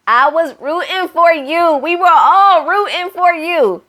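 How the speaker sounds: high, slow exasperated cry; mock-tragic rant at the TV; dramatic scolding. Real, not acted. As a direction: let him down with theatrical disappointment. Scolding Marshall on the show.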